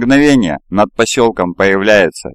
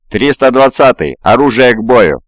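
shouted command; 4 semitones higher, 4 dB louder than usual. A narrator's reading. Radio command in combat.

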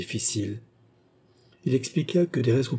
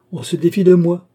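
indignant outburst; 8 semitones higher, 9 dB louder than usual. Acted. Portugais protests being mistrusted.

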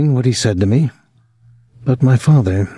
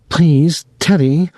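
higher, urgent little plea; pleading; 7 semitones higher, slower than usual. A narrator's reading. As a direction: beg him to stop. Faint woman pleading to stop.

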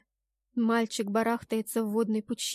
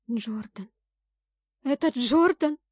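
shocked recognition; 2 semitones higher, 5 dB louder than usual. A narrator's reading. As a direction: realise in shock who it is, rising into a cry. Heroine recognises the boy, shaken.

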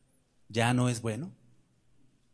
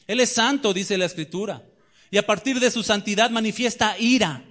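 raised, ringing pulpit voice; fiery preaching; 6 semitones higher, 4 dB louder than usual. Acted. Preacher challenging the congregation, raised and ringing.

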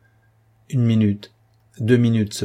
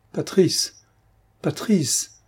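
called out much higher, frightened; crying out; 8 semitones higher, much slower than usual. A narrator's reading. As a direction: cry his name out into the dark, much higher. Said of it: "cria Coralie". (appel affolé, voix bien plus aiguë)

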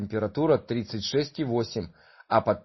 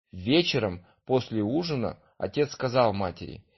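plain reading voice

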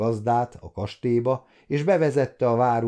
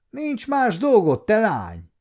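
snapping; 11 semitones higher, 5 dB louder than usual. A narrator's reading. Cook scolding the kitchen girl, angry snap. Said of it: "förmet rá".

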